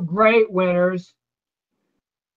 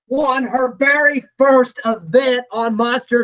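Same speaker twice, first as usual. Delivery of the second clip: excited shout; 5 semitones higher than usual. Real, not acted. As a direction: yell out the winner, thrilled. Announcer calls the race win.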